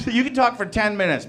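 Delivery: ordinary delivery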